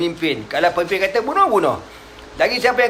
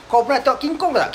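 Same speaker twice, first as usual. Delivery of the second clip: much higher, showy hawker's voice; boastful storyteller; 9 semitones higher than usual. Retold or imitated. Nadr pulling listeners from the Prophet, high hawker voice. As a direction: lure the crowd away, higher and showy.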